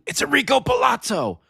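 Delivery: high, excited movie-line exclamation; Naked Gun quote. Retold or imitated. Host quoting the Naked Gun at a viewer, excited exclamation. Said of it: "from the Naked Gun?".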